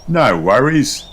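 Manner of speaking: bright easygoing reassurance; Australian dad cheering everyone; cheery Aussie. A narrator's reading. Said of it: "says Mister Kangaroo".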